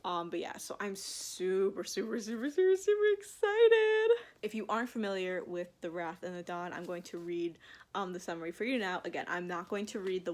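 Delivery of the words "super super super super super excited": building excitement. Real, not acted. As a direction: stretch out the supers, then burst high and loud on excited. Gushing about new book haul.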